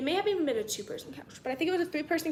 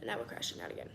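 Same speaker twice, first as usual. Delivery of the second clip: muttered echo; 13 dB quieter, faster than usual. Real, not acted. Repeated, much quieter.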